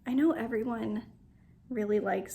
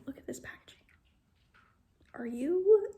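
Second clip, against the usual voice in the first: whisper; 6 semitones higher, 3 dB louder than usual. Real, not acted. Whispered awe at packaging.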